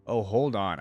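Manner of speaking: usual speaking voice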